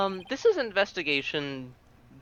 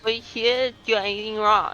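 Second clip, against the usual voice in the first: rando voice; 7 semitones higher, 5 dB louder, much slower than usual. Acted. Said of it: "absurd enough rando voice".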